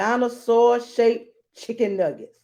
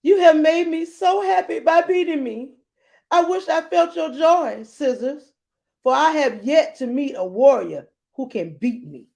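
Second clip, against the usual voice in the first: higher, bright-eyed grateful warrior; a pitched-up rock figure, overjoyed at losing; 8 semitones higher, 4 dB louder than usual. Acted. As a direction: thank your conqueror with delight. Rock voiced grateful to victor.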